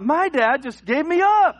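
put-on high, loud, slow kid disbelief; a stunned child realizing he's been sold out; betrayed kid. Kid betrayed by his dad.

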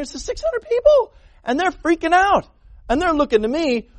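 high, strained panicked king; panicky Saul. Acted. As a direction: voice Saul freaking out.

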